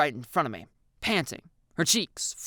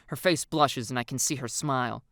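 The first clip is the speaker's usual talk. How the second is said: ordinary delivery, nothing marked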